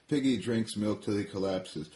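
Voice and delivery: plain reading voice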